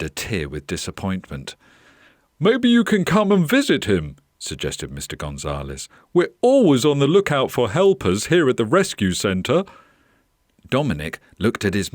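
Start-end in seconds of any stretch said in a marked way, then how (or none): 2.4-4.2 higher, louder storybook suggestion; animated, projected invitation; encouraging owner. Acted. Owner makes a hopeful suggestion. 6.1-9.6 higher, louder storybook voice; animated rescue centre owner; encouraging owner. Acted.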